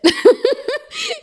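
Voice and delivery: high-pitched burst of laughter; high giggle. Real, not acted. Amused by the bad breakdancing.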